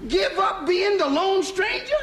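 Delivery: high, slow, disbelieving question; incredulous. Acted. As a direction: question it slowly in comic disbelief. Partner proposes quitting the act.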